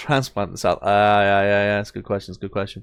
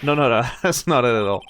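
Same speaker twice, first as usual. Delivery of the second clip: ordinary talk, nothing marked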